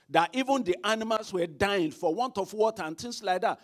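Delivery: fiery pulpit preaching; preaching at full force. Acted. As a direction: preach it out, ringing loud.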